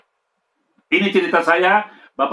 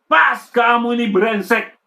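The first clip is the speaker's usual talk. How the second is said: father's angry shout; 6 semitones higher, slower than usual. Retold or imitated. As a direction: bark the insult down at the boy. Father slaps and insults son.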